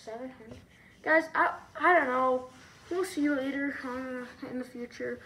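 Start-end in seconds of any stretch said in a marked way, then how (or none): 1.0-3.8 exasperated. Real, not acted. Reacts to seven hundred idea.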